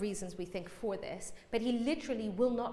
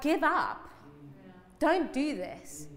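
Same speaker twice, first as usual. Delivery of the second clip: tempting inner voice; 5 semitones higher, slower than usual. Retold or imitated. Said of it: "everything in me is saying".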